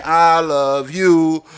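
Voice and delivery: very slow, sweet kiddie-show "I love you"; Barney voice. Pretend. Softening the cursing for a viewer, in a slow syrupy Barney voice. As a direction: stretch it out like a purple dinosaur singing to kids. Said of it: "I'll Barney it for you".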